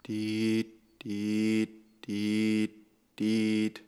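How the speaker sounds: gleichmäßiges Piepen im Takt, wie ein Warnton; Piepen nachgemacht. Retold or imitated. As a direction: ahmt das Rückwärtspiepen nach. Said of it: "das Piepen eines Müllautos". (Piepton nachahmen)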